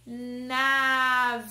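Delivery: NAVIO long and loud, with a held N; stretched sounding-out. Shown as a demonstration. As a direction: draw out "navio" as nnnaa-viii-o. Teacher stretches word to show syllables.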